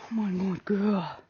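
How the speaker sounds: slow, soft and low, almost murmured; tender praise. Real, not acted. Trainer softly praising the mare as she relaxes, tender and slow. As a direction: soothe the mare with gentle praise.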